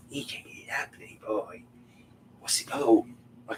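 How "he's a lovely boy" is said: whispering. Real, not acted. Cooing to the dog.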